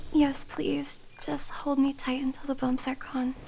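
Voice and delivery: hushed plea to be held; soft pleading whisper. Acted. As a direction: whisper it, asking to be held tight.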